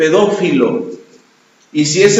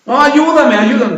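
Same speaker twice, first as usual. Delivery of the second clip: higher, louder begging; insistent begging, higher; pleading drunk; 8 semitones higher, 3 dB louder than usual. Retold or imitated. Drunk man begging again.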